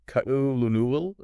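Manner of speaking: "Ku'ulunul" stumbling through every written letter; sounded-out mispronunciation. Shown as a demonstration. Co-host showing how people attempt colonel, sounded-out mispronunciation. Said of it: "most people try to sound it out like".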